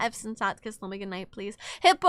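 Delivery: usual speaking voice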